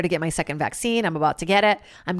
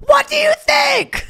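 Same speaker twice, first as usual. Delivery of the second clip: high, loud, half-crying exasperated shout; screaming at the tipsy boyfriend over the stinking dog; exasperated yell; 8 dB louder, slower than usual. Retold or imitated. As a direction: yell at him in frustrated panic. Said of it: "I was like".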